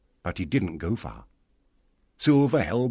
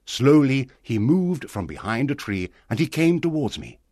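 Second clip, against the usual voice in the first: ordinary delivery, nothing marked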